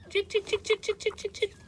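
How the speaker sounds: high sing-song chick chick call; calling chickens. Real, not acted. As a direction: call the hens in, high and light.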